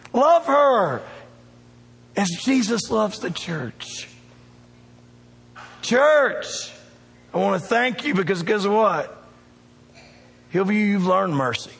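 rising revival preaching; preaching at full force. Acted. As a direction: lift it up and drive it home to the church.

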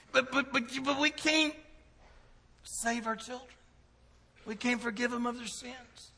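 high-pitched mock helplessness; sputtering protest. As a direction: stammer it high, like a parent at a loss. Acts out parents' helpless protest.

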